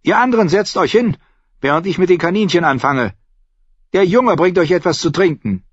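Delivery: high-pitched bossy innkeeper; commanding. A narrator's reading. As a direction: order everyone to their places. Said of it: "befahl sie".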